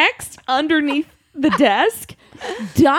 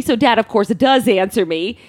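normal talking voice

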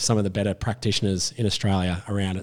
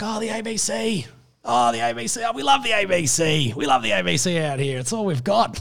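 high, gushing imitation of country fans; mock-adoring voice, pitched way up; adoring regional locals; 10 semitones higher than usual. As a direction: coo with fond delight as a local. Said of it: "love for the A B C".

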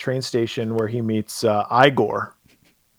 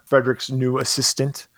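ordinary talk

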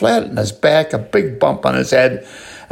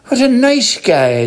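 leprechaun to himself; 11 semitones higher, slower than usual. A narrator's reading. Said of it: "the leprechaun says to himself".

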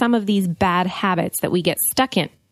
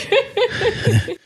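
high burst of laughter; short, high, amused laugh; 13 semitones higher than usual. Real, not acted. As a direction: laugh at being teased. Laughs at being called sarcastic.

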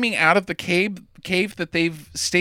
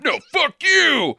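defiant yell; 9 semitones higher than usual. Retold or imitated. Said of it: "This is the penultimate scene of".

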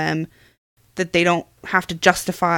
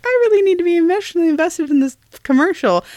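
high, quick put-on voice; mock earnest resolve, pitched high and rushed; self-mocking; 10 semitones higher, faster than usual. Wife mocking the idea that she tries, self-mocking.

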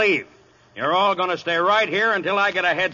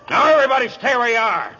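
loud, raised-pitch command; commanding order; 5 semitones higher, 4 dB louder than usual. Acted. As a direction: order the whole room to freeze. Chief holds the room back.

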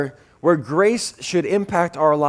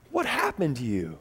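ordinary talk

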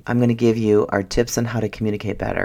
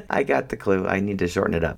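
usual speaking voice

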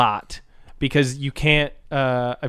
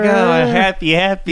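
mock happy voice; 6 semitones higher, 4 dB louder than usual. Mocking the girl's cheer.

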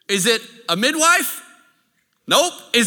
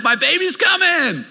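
a dad bursting with excitement to his friends; excited shout; 9 semitones higher than usual. Retold or imitated. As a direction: shout it out in pure giddy joy. Texting friends during labor.

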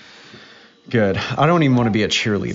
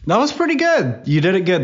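excited praise; 10 semitones higher, 4 dB louder than usual. Real, not acted. Dad praising daughter's line.

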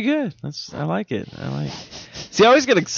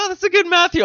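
high mock quote; faster than usual. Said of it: "she's like".

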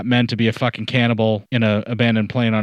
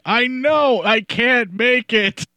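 higher pleading whine, slow; whiny weak voice; 12 semitones higher, much slower than usual. Retold or imitated. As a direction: whimper it like a helpless kid. Imitating a helpless friend, whining.

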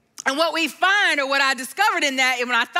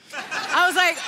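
usual speaking voice

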